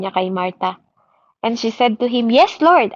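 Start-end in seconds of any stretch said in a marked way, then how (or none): 2.3-2.9 high, eager yes. Acted. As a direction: answer him eagerly, voice lifted. Martha voiced answering Jesus.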